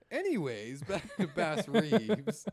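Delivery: loud, high and drawn out through laughter; laughing segue. Real, not acted. Steering back to the topic, laughing loudly. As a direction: laugh while stretching the words.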